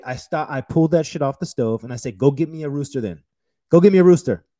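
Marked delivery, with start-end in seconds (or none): 2.2-4.4 loud, raised-pitch demand; re-enacted kitchen outburst; angry challenge. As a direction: repeat the order, more forceful each time. Acted showdown with French chef.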